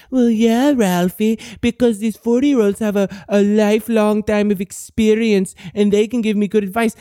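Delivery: mock naive listener. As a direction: play the gullible kid, half-hearted. Mocking a naive young listener.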